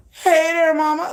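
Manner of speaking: loud, high come-on; flirty catcall. Acted. Women calling out to her, flirty catcall. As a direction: call out to someone you fancy, hammed-up.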